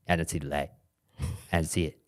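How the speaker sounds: low, soft, puzzled imitation; hushed mock bewilderment; deflated disbelief. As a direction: quietly quote a man baffled by his failed experiment. Flat earther baffled looking through slit.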